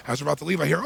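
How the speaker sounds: ordinary talk, nothing marked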